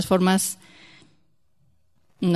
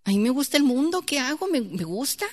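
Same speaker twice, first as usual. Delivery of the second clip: higher-pitched, imitated plea on the edge of tears; near-tearful young man; 5 semitones higher than usual. Young man confessing to his pastor after the sermon, almost crying. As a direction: lightly act out a boy almost crying. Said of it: "casi llorando el muchacho".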